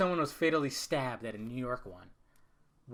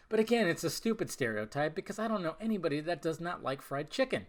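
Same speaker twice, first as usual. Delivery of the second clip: normal talking voice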